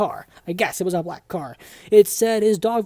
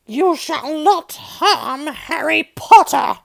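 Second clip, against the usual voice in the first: higher, slow, odd elf voice; house elf voice; 7 semitones higher, 6 dB louder, much slower than usual. Acted. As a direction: squeak it out slowly like a house elf. Dobby the elf quoted.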